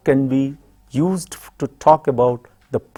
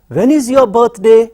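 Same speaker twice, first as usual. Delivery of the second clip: lifted question; 9 semitones higher, 6 dB louder than usual. Shown as a demonstration. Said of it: "teacher models question".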